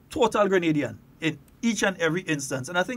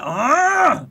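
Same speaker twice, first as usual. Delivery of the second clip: long, loud, high "Ah"; St. David's man's cry; 8 semitones higher, 8 dB louder than usual. Retold or imitated. Imitating a local man on air, loud high cry. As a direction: belt out a high, drawn-out "Ah". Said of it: "David's man talking there".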